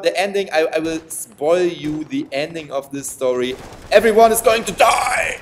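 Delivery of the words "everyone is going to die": loud high mock-evil proclamation; hammed-up villain shout. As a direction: announce doom like a cartoon villain. Villainous spoiler of the story.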